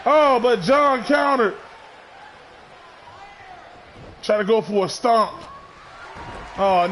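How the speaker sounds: steady ringside call, punchy on each move; live play-by-play. Acted. Commentator calling the match for his viewers, play-by-play. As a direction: call every hit like a ringside announcer.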